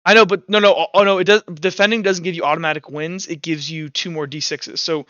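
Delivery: normal talking voice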